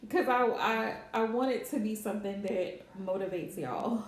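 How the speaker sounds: ordinary talk, nothing marked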